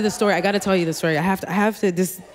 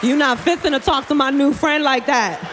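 hammed-up defiant warning, pitched up; mock protective; 5 semitones higher than usual. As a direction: defend your new friend in a put-on voice. Acted defence of new friend.

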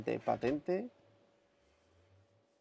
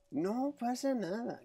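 high, slow sarcastic singsong; mock reassuring; 10 semitones higher, slower than usual. Real, not acted. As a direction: reassure yourself with irony. Comforting self ironically.

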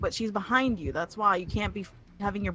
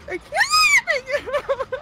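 much higher, slow, loud wail of the line; desperate wail; 6 dB louder, much slower than usual. Real, not acted. Player fleeing the pig, slow high despairing wail. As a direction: cry it out as if at the end of her rope. Chased by killer.

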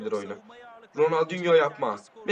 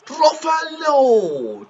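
very slow, high, loud stretched name; drawn-out commentator call; 10 semitones higher, 8 dB louder, much slower than usual. Real, not acted. (uzatarak spiker bağırışı)

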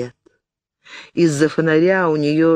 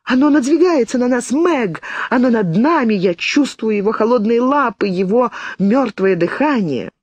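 terrified; 8 semitones higher than usual. A narrator's reading. Frightened girl describing the ghost.

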